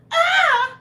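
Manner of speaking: very high, loud mock shriek; imitated high-pitched scream. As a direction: mimic a panicked man's falsetto battle cry. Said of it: "His voice went up five".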